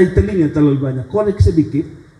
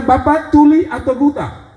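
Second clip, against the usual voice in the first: annoyed question; 10 semitones higher, slower than usual. Retold or imitated. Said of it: "Saya bilang".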